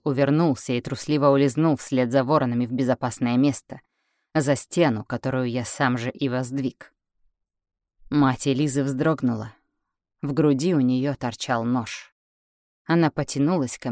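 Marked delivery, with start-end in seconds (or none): none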